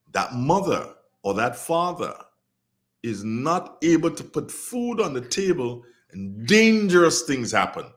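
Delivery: usual speaking voice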